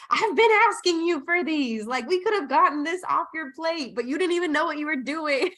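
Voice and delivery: playful disbelieving rant at the client; exasperated coach. Retold or imitated. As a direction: scold lightly, pitch climbing, half laughing. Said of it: "And I'm like".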